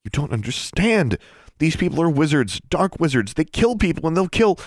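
high, rising frantic urgency; frantic pleading. A narrator's reading.

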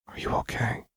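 soft whispered question; quiet. A narrator's reading. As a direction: ask it gently under the breath. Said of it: "Lux asked quietly".

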